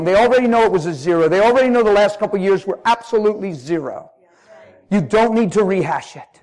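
loud urgent preaching; loud fervent preacher; preaching at full force. Real, not acted. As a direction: drive the point home.

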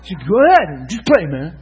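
loud, exasperated giving-in; grudging consent. Retold or imitated. Man reluctantly letting the preacher pray, grudging consent. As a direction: push it out loud, then drop off on the last word. Man gives in again, retold.

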